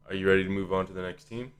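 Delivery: ordinary talk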